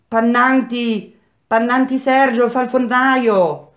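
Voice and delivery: very high, slow, loud name call; woman shouting. A narrator's reading. Wife calling a prisoner for his mother, shouting. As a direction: stretch the name out across the street. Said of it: "tornò a gridare".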